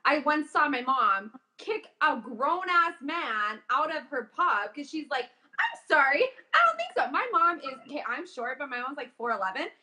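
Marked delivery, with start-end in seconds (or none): 5.6-7.1 high-pitched mock indignation, imitating a mother; indignant mom. Daughter imitates mom kicking man out of pub, indignant. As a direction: play an affronted mom refusing to have it.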